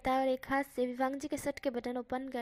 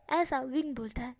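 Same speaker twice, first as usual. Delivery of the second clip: ordinary delivery, nothing marked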